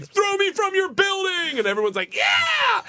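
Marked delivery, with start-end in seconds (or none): 0.1-1.6 very high, drawn-out bravado yell; hyped-up mock daredevil cry; showboating holler. Acted showboating call to the crowd. 2.1-2.8 very high whooping cheer; mock roar of the crowd.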